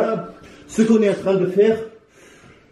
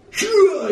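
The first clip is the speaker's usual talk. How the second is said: explosive whoosh; 12 semitones higher than usual. Retold or imitated. Imitating explosive punch sound.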